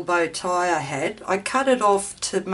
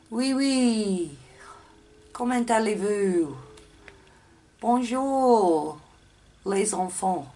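hammed-up English speaker's French accent; bad French accent; slower than usual. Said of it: "how bad my accent is".

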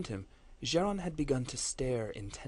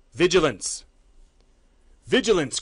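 much louder ringing salute cry; cried out; 6 semitones higher, 12 dB louder than usual. Acted. Battle lords saluting the matriarchs together, loud ringing cry. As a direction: call it out like a salute. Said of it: "cried the other battle lords in unison".

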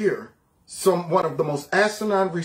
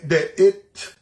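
ordinary talk, nothing marked

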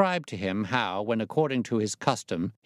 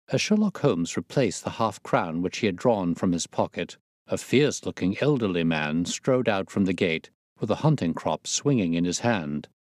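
plain reading voice

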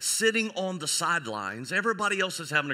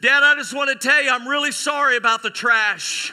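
loud, high, overdone sorry; hammed-up public apology; 9 semitones higher, 8 dB louder than usual. Graduate apologizes to dad over the mic, loudly hammed-up. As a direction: blurt the apology out to the whole hall.

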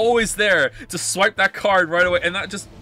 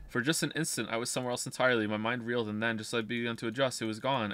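story narration; 8 semitones lower, 8 dB quieter, faster than usual. Acted. Reads game narration aloud.

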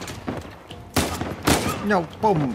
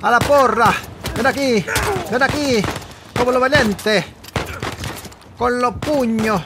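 high, loud fighting shouts; angry yelling; 8 semitones higher, 4 dB louder than usual. Real, not acted. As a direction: yell at the enemy. Fistfight in game, yelling.

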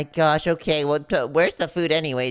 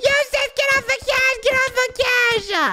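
frantic yelling; 4 dB louder, slower than usual. Acted. Friend being attacked.

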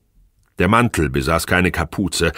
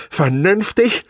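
high, scornful rising Vernünftig?; mocking echo; 13 semitones higher, slower than usual. Wife mocking husband's plea on the phone, high scornful echo. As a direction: throw his word Vernünftig back at him.

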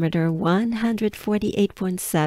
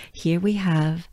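usual speaking voice

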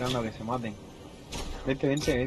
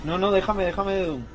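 excited protest; 6 semitones higher, 4 dB louder than usual. Real, not acted. Protesting in the heat of play.

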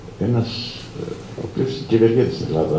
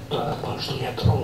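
feigned hoarse whisper; 4 semitones higher, 6 dB quieter than usual. Retold or imitated. Father feigning lost voice.

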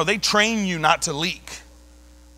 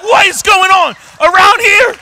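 loud, higher demanding shout; retold shout; 10 semitones higher, 10 dB louder than usual. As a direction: yell it at the frozen crowd. Shouting at frozen passengers.

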